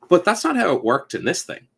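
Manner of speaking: indignant complaint. Retold or imitated. Player quoting rules, complaining.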